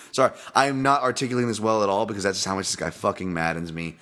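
normal talking voice

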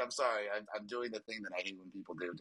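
usual speaking voice

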